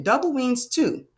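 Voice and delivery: ordinary talk, nothing marked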